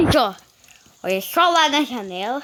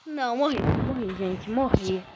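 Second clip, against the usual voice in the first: dejected; 7 semitones lower, 7 dB quieter, slower than usual. Real, not acted. Character just died.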